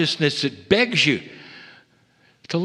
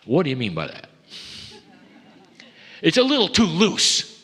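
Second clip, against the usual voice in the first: skeptical retort; 2 semitones higher than usual. Retold or imitated. Human rejecting a lover's words, mock skeptical. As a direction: push back with mock suspicion. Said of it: "fold their arms and say".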